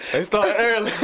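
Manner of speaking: laughing. Real, not acted. Host laughing.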